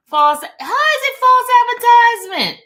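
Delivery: high, loud indignant cry; loud, high disbelief; mock outrage. Real, not acted. Outraged at chat's accusation.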